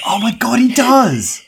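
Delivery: high delighted shout; excited. Real, not acted. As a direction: burst out in recognition.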